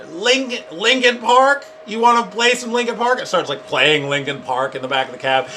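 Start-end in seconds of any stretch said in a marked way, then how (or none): 0.1-3.2 mock voice assistant. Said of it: "Google Voice".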